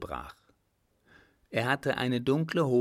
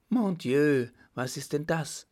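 startled cry; 4 semitones higher, 4 dB louder than usual. A narrator's reading. Lost girl startled in woods.

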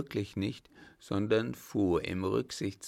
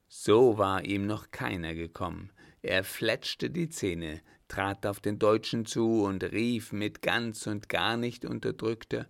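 usual speaking voice

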